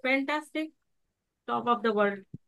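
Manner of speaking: limp, subdued claim to be great; dull, the opposite. Shown as a demonstration. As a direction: say it lazily, with no belief. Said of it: "लेकिन यदि इसका उल्टा करें".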